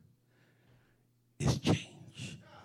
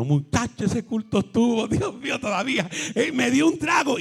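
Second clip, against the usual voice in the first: higher, excited gossip about the service; gossipy churchgoer; 8 semitones higher than usual. Pretend. A church lady gushing on the phone, higher. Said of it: "hablando con alguien diciendo".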